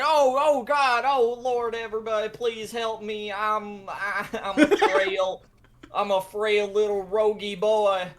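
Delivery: high, wailing cry for rescue; hammed-up damsel-in-distress panic; mock pleading. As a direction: beg pitifully like a helpless weakling. Wounded rogue begs party for help.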